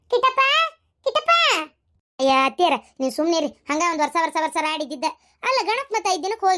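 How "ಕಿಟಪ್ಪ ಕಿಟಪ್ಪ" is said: high, rising call. Acted. Grandmother calls crying grandson back, high calling voice. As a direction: call the child's name to fetch him.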